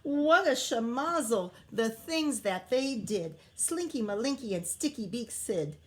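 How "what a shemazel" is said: normal talking voice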